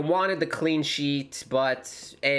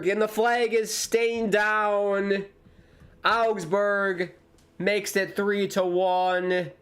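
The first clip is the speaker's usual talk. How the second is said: excited goal call; 7 semitones higher, much slower than usual. Real, not acted. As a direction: shout the goal like a live commentator. Augsburg score a goal.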